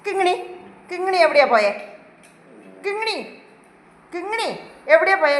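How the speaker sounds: high, loud pretend telling-off; mock scolding. Owner pretend-scolding the pet to make it laugh. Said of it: "ദേഷ്യപ്പെടണം ചിരി കാണണമെങ്കിൽ".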